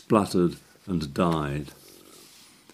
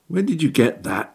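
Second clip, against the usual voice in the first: sharp high snap; snapped question; 9 semitones higher than usual. Acted. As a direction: snap at him in suspicion. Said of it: "I snapped".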